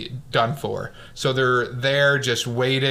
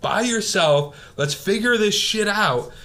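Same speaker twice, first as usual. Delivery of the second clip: mock freak-out, pitched up high; retold freaking out; 7 semitones higher than usual. Sanborn freaks out at his partner's recklessness, imitated high-pitched panic. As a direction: play a panicked teammate, voice climbing. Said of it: "And he's freaking out".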